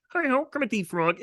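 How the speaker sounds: high froggy puppet voice; bright, squeaky frog puppet; Kermit the Frog. Retold or imitated. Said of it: "I was like".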